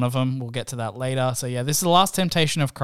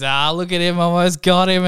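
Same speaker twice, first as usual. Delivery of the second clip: louder, higher gloating shout to the room; joking crow; 5 semitones higher, 6 dB louder than usual. Retold or imitated. Gangster lets his friends in on the prank, mock triumphant crow. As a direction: crow it loudly, imitating a joker revealing his prank. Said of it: "they just all start laughing again".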